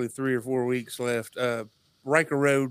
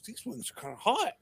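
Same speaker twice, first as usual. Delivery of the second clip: mumbled remark; 9 semitones higher, 6 dB quieter than usual. Retold or imitated. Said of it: "she mumbles".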